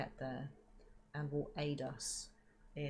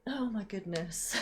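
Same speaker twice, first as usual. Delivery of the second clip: laughing; 5 semitones higher, 4 dB louder than usual. Real, not acted. Flustered right after a loud sneeze.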